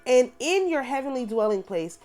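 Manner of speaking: solemn, measured prayer reading. Acted. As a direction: pray it aloud as scripture. Reads prayer aloud.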